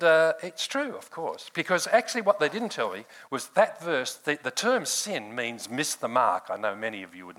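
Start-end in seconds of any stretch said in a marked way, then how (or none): none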